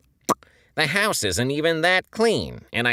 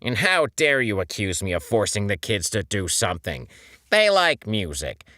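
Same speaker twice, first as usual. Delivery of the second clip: fiery anger; 5 semitones higher, 4 dB louder than usual. A narrator's reading. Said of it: "felt a fire in his eyes".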